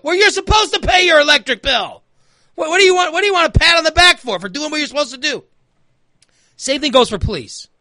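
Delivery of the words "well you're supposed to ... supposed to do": high-pitched, indignant rebuke; acted exasperation. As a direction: fire back with mock outrage, high-pitched. Acted rebuke of bill payer.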